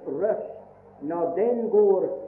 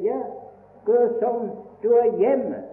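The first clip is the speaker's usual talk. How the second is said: higher, louder, a cheerful hostess inviting him in; welcoming host; 7 semitones higher, 6 dB louder than usual. Retold or imitated. Preacher voicing a family welcoming him, hearty and loud. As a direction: play the hearty host saying come in.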